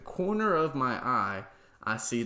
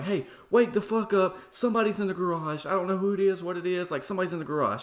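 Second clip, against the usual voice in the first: panicked scream; 9 semitones higher, 4 dB louder than usual. Retold or imitated. Waking his fiance about someone in the garage, panicked shouting. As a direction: scream her awake in panic. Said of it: "screaming for my fiance".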